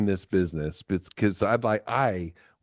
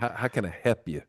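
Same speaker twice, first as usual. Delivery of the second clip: imitated Southern "help"; Texan phone greeting with a drawn-out vowel; Southern drawl; 3 dB quieter than usual. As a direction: play up the Southern accent on help. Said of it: "it doesn't say help".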